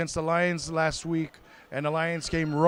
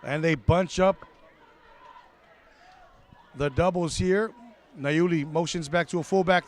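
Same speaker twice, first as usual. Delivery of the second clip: ordinary talk, nothing marked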